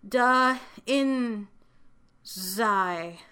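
halting early reader's attempt, with gaps between syllables; sounding out. A narrator's reading. Child trying the cards anyway, sounding out.

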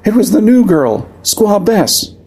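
high, strained panicked voice; shredded rasp. Acted. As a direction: force the words out through fear. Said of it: "her voice was a shredded rasp".

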